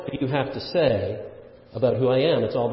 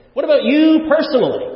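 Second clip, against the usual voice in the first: "you" much higher and louder, falling off; stress on YOU; 10 semitones higher, 8 dB louder than usual. Shown as a demonstration. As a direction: press the word "you" hard. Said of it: "is emphatic there".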